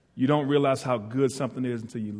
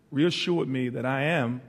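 ordinary talk, nothing marked

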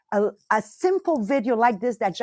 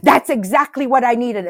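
excited aha; 2 semitones higher, 8 dB louder than usual. Retold or imitated. Listener finding the right video, loud excited aha. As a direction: burst out with relief at finding it. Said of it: "you're gonna go".

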